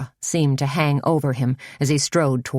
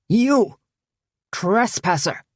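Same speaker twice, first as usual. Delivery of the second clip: strident shout; 6 semitones higher, slower than usual. A narrator's reading. A cult leader yells at a trespasser, strident. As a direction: bark it at the stranger. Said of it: "he shrieked in a strident voice".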